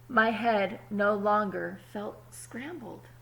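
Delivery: put-on calm storybook reading to kids; story narration. A narrator's reading. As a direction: read it like a picture book at story time. Reads the story aloud.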